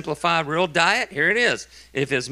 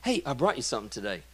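sweet coworker; 5 semitones lower, 9 dB quieter, faster than usual. Retold or imitated. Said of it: "He'll go, super sweet".

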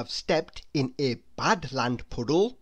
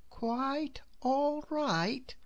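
high, slow, much softer wonderment; repeating to himself; 8 semitones higher, 7 dB quieter, much slower than usual. A narrator's reading. Mister Good repeating the kind words softly to himself. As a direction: murmur it back in disbelief. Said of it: "repeated Mister Good to himself".

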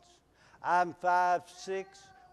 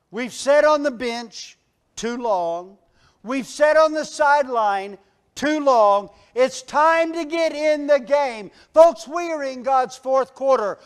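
high, loud rallying sermon; rally the church like a coach at halftime; preaching at full force; 8 semitones higher, 12 dB louder than usual. Acted. Preacher rallying the congregation, loud and high.

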